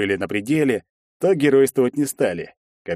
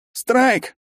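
high hoarse cry from the floor; 14 semitones higher, 3 dB louder than usual. Acted. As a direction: croak it out hoarsely, dazed. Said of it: "прохрипел".

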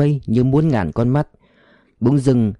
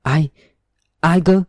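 stunned stammer; 5 semitones higher than usual. A narrator's reading. Old father startled by his lost son, stunned stammer. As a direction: stammer in shock at the sudden voice. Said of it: "sững sờ lắp bắp".

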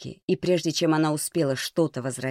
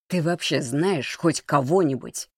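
usual speaking voice

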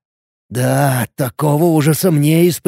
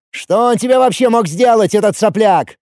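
raised, incredulous angry question; возмущённый крик; 7 semitones higher than usual. A narrator's reading. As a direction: demand it in a high, heated voice. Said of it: "взревел в ответ ошеломлённый новостями". (возмущённо, на повышенных тонах)

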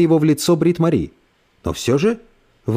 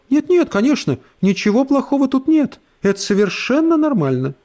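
hasty sugary; 11 semitones higher than usual. Acted. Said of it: "поспешно добавила".

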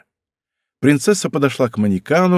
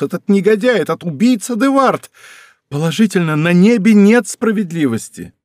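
rising indignant cry, quick at times; indignant outburst; 5 semitones higher than usual. A narrator's reading. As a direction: pour out her outrage at the coward. Princess outraged at the duelist.

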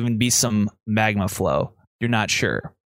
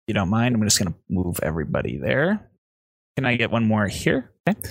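ordinary talk, nothing marked

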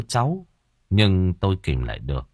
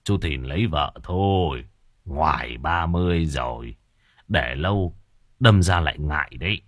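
put-on old neighbour; 4 semitones lower than usual. A narrator's reading. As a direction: speak as an elderly man giving low-key advice. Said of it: "Ông Cải thường nói với tôi".

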